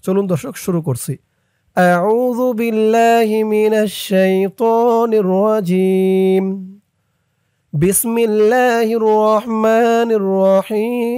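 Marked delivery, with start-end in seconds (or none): 1.8-11.1 slow melodic tajwid chant; measured Quran recitation; Quranic recitation. Shown as a demonstration. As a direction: recite slowly with a drawn-out melody.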